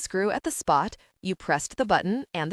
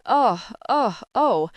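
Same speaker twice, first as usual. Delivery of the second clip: louder, higher despairing moans; moaning; 4 semitones higher, 5 dB louder, slower than usual. A narrator's reading. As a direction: groan out each oh in despair. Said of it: "moaned, clasping his forehead".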